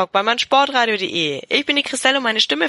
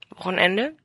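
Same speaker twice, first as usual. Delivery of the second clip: ordinary delivery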